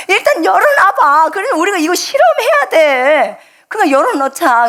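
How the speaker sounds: half-laughing, pressing the point; urging insistence. Retold or imitated.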